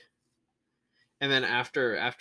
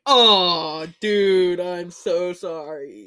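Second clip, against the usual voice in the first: mock sympathy; 6 semitones higher, 9 dB louder, much slower than usual. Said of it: "And I was like".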